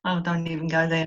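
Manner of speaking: ordinary talk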